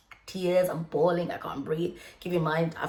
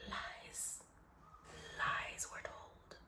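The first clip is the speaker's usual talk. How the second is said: spooky whisper; 20 dB quieter, much slower than usual. Acted. Confessing she works badly under pressure.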